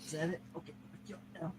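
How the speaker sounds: very soft, high murmur to a cat; whisper-close coaxing to the cat; soft pet talk. Real, not acted.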